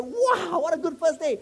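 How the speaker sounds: high, bright delighted exclamation. Pretend. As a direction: cheer at the good day. Delighted quoted reaction.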